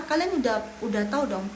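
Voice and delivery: ordinary delivery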